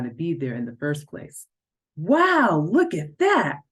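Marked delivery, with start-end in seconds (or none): 1.9-3.6 high, bright-eyed wow; thrilled gasp at the dancers; excited laughing exclaim. A narrator's reading. Best friend marvels at the dancers, excited. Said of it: "Tessie laughed".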